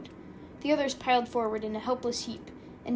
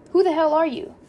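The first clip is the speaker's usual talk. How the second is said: loud, higher-pitched demand; indignant challenge; 5 semitones higher, 10 dB louder than usual. A narrator's reading. Drunk driver to an unknown passenger, indignant challenge. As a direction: demand it loudly of a stranger.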